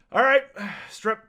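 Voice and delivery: a weary doctor barking to strip; sighing order. Acted. Doctor tells Verstadt to undress, sighing and loud.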